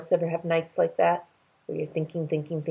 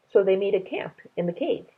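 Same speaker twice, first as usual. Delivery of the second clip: usual speaking voice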